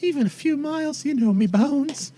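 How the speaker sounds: put-on frail old dear begging off; old townswoman. Old lady declining to travel far, high creaky-boned protest.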